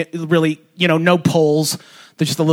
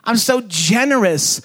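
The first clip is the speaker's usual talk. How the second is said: high, slow, self-satisfied boasting; proud little kid; 6 semitones higher, slower than usual. Retold or imitated. Little kid basking in mom's praise, high and smug. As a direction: preen like a kid on cloud nine. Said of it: "It's just like".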